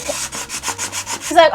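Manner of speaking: ordinary talk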